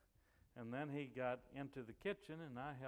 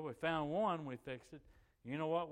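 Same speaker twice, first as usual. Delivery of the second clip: ordinary talk, nothing marked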